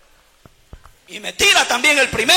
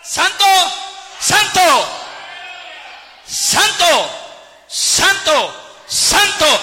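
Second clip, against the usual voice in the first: crying out; 4 semitones higher, much slower than usual. Acted. Preacher voices heavenly beings praising, crying out. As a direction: cry it out like a worshipping chorus. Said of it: "dando voces diciendo".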